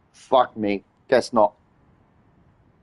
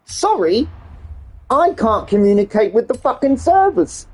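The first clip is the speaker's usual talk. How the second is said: a higher mock voice reading the error; mocking the error message; 4 semitones higher than usual. Streamer mocks Forza's error message in a higher voice. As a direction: parrot the game's apology sarcastically. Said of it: "There it is again".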